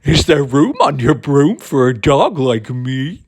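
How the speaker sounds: eager, loud, bouncy dog voice; a keen dog begging to come along; big friendly dog pleading. A narrator's reading. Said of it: "as the witch pulled... then eagerly said".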